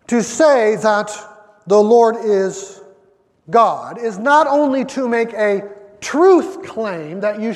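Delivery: loud, slow, emphatic pulpit proclamation; preaching at full force. Acted. Preacher proclaiming the Shema to his church, loud and emphatic. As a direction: declare every word to the congregation.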